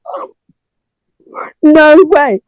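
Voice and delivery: high, loud disbelieving no way; surprised gasp. Retold or imitated. Retelling her reaction to learning it was [name], surprised. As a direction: blurt it out, stunned.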